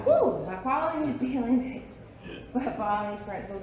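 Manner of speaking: laughing. Real, not acted. Reader laughing, restarting line.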